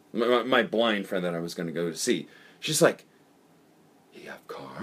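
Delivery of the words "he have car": whisper. Retold or imitated. Girlfriend's broken English quote.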